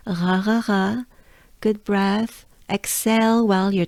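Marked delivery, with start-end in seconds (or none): 0.1-1.0 Ra with a French throat R; throaty French R on each ra. Shown as a demonstration. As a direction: exhale the R of Ra, tongue down. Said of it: "there is the French R again".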